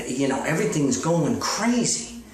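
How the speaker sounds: ordinary delivery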